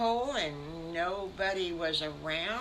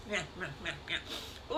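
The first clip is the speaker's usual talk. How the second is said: soft, whispered little meows; sleepy meowing; 7 dB quieter than usual. Acted. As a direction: mew like a waking kitten. Piglet wakes up mewing.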